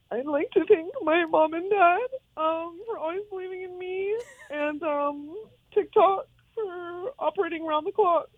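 mock tearful thank-you speech, high; fake-cry through the thank-yous; teary acceptance speech. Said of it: "giving an acceptance speech for us?".

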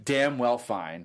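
usual speaking voice

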